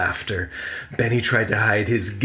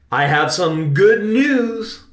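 excited announcement; 8 semitones higher, 6 dB louder, slower than usual. A narrator's reading. Uncle's mischievous announcement.

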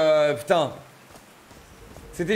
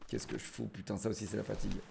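low, soft annoyed mutter; muttering to himself; 9 semitones lower, 18 dB quieter than usual. Real, not acted. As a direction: grumble it under your breath. Muttering to himself after mistake.

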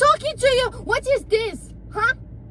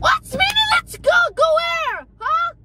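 furious shout; 4 semitones higher than usual. Acted. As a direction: blow up at him, outraged. Wife furious at husband.

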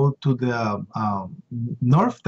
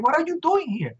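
much higher, pressing question; urgent repeated question; 14 semitones higher than usual. Retold or imitated. Rescuer urging the guard to leave, higher and more urgent. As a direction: push her harder, pitch climbing.

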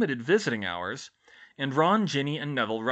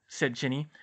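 ordinary delivery, nothing marked

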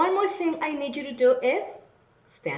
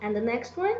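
ordinary talk

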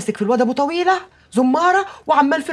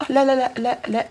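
ordinary talk, nothing marked

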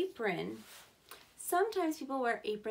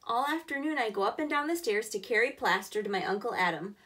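louder, clear storybook reading; reading to children; 4 dB louder than usual. Acted. Teacher reading the boy's workday to kids, louder storybook voice. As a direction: give every step of the workday a clear read. Reads picture book aloud.

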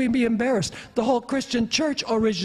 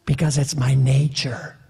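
scorpion's cold reply; 8 semitones lower than usual. Retold or imitated. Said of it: "the scorpion said".

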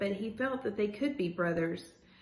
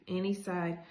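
ordinary delivery, nothing marked

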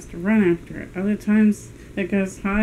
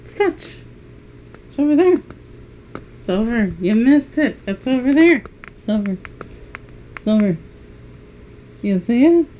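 higher, louder coaxing the dog; 4 semitones higher, 6 dB louder than usual. Real, not acted. Owner telling her dog Silver the toy is over there, high and loud. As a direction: call out high to point the dog the way.

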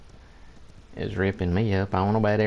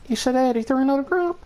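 shocked; faster than usual. Real, not acted. Shocked question to teammate.

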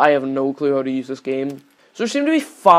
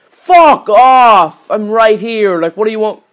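angry yell; 5 semitones higher, 9 dB louder, slower than usual. Real, not acted. Yelling at the game in frustration.

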